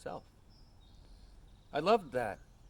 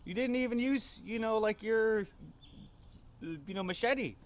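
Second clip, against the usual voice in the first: put-on much higher, louder child's voice teasing dad; little girl; 11 semitones higher, 6 dB louder than usual. Little daughter teasing dad about the bear, high child voice. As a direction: squeak it out like a giggling little daughter. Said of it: "and she goes".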